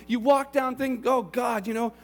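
pleading repentance. Acted. Repentant sinner's inner voice.

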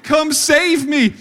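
loud, slow mock cry for rescue; hammed-up damsel plea. Wife calls husband about a stair spider, hammed-up plea. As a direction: ham up a helpless plea to be saved. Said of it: "she's like".

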